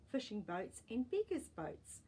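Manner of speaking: plain reading voice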